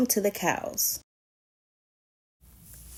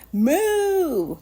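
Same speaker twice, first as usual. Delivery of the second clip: high drawn-out cow moo; 7 semitones higher, 3 dB louder than usual. Acted. Reader voicing the cows, high mock moo.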